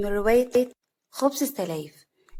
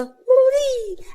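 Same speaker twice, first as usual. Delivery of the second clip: very high, drawn-out zaghrouta; high trilling cry of joy; celebratory ululation; 6 dB louder, much slower than usual. A narrator's reading. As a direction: let out a long, loud ululation. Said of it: "سعاد بزغروطه".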